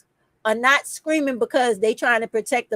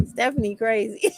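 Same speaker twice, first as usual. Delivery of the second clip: laughing quote. Retold or imitated. Quoting Juanita about her, laughing. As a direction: giggle through the quote. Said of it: "Juanita like".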